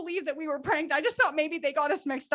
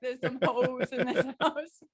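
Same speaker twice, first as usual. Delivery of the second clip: laughing; slower than usual. Pretend. Quoting [name] song.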